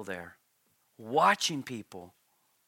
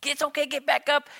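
put-on higher, coaxing parent calling to a fallen child; 11 semitones higher, 4 dB louder than usual. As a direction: lift the voice to cheer a kid up off the ice. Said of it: "you watch your kids and you go".

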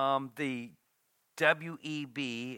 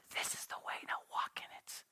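soft whisper of the Lord; God's still small voice, whispered; 12 dB quieter than usual. Acted. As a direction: breathe the words gently. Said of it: "the Lord is whispering to you".

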